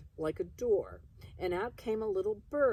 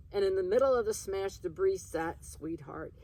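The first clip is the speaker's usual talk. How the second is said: ordinary delivery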